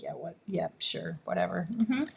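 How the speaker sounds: low, soft unimpressed mumble; deadpan cat voice, low and soft; bored cats. Retold or imitated. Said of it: "And the cats are like".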